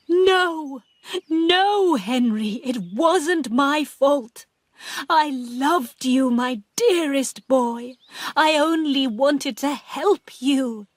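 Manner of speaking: high, slow, frightened begging; desperate pleading. Acted. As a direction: beg the ghost for forgiveness. Pauline pleading with ghost.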